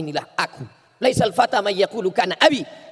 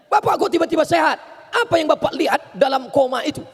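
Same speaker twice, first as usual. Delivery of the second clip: astonished doctor; 7 semitones higher than usual. Retold or imitated.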